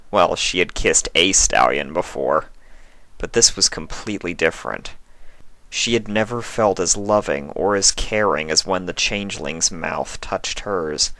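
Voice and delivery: ordinary delivery, nothing marked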